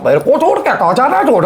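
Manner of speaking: very high, strange pleading cry; inhuman creature voice. Retold or imitated. The jinn begging the sailor not to leave, in an eerie high cry. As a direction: pitch it way up like something not human begging. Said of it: "वो इंसानी लहजा नहीं था".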